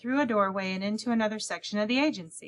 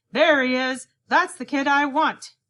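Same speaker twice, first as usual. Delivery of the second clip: loud, slow triumphant call; 5 semitones higher, 8 dB louder than usual. A narrator's reading. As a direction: point and call out across the room. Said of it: "he pointed and called".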